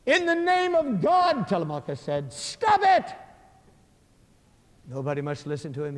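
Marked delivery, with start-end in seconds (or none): 0.1-1.5 slow, high, loud cry; calling out. Acted. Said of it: "calls out". 2.3-3.1 very high, loud shout; calling out. Acted. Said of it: "calls out".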